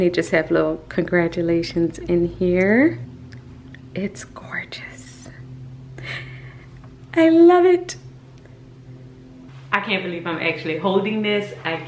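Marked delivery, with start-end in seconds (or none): 3.9-5.3 slow, much softer breathy whisper; awed whisper. Real, not acted. Admiring the plaque in awe. 7.1-8.0 joyful exclamation. Real, not acted. Overjoyed holding the plaque.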